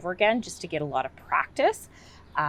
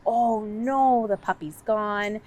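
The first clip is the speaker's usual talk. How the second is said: higher, slow storybook dismay; mock worried oh no; exaggerated oh no; 6 semitones higher, slower than usual. Shown as a demonstration. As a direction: play up the lost puppy for a child.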